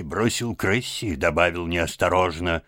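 ordinary delivery, nothing marked

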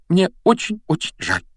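put-on high, regretful girl's voice; mildly pained regret. A narrator's reading. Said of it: "негромко сказала она".

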